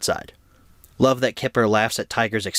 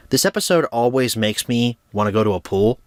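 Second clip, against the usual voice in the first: ordinary talk, nothing marked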